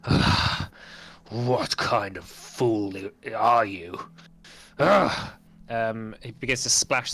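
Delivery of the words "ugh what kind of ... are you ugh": groaning, growled self-reproach, drawn out; rough, breathy snarl between groans of disgust; werewolf cursing himself. Acted. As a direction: curse yourself like a wounded werewolf. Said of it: "he's cursing himself".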